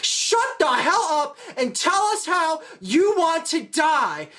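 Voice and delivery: high, slow, emphatic mock outburst; angry demand. Cannibal slaps Shane mid-ramble, exasperated acted demand. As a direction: cut him off and hit each word.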